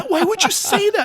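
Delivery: imitated high, horrified question; mock shock. Employee pressing the clueless boss for a reason, mock shocked. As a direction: ask it aghast, way up high. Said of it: "the guy literally almost passed out".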